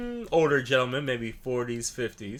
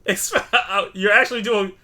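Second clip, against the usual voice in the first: laughing; 9 semitones higher, 10 dB louder, slower than usual. Real, not acted. Laughing urging co-host.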